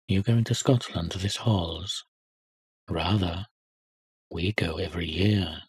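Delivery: a hint of bragging prep-school boys; snobbish schoolboys. Schoolboys boasting about Scotland.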